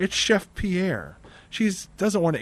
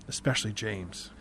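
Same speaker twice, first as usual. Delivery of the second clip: "especially James" dropped much lower and softer; a quiet, knowing "especially James"; hushed repeat; 5 semitones lower, 7 dB quieter than usual. Retold or imitated. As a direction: sink the repeat into a confiding hush. Joy repeats it more quietly.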